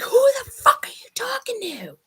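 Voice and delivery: high, harsh retold shout at the nephew; retold furious yell. Aunt yelling at her nephew outside the house, high angry retold shout. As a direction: fire it back like an angry aunt. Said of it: "And I'm like".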